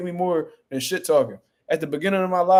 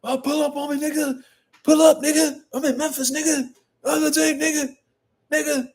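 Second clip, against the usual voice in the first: high mocking imitation of a tough guy, much higher; 10 semitones higher than usual. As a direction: mimic a taunting tough guy in a squeaky high pitch. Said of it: "you talking".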